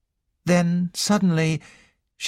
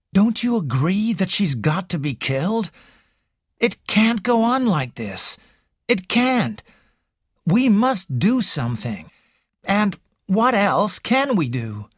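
put-on higher, pressing young man; urgent Raymond; 6 semitones higher than usual. A narrator's reading. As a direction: push the sister, desperate but restrained.

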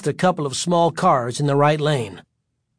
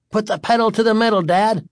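quick, high, eager urging; 8 semitones higher, 4 dB louder than usual. A narrator's reading. Son in back seat to dad, urging. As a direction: egg him on, fast and pitched up. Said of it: "Terry urged".

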